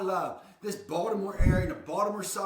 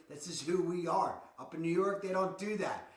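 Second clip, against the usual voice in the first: ordinary delivery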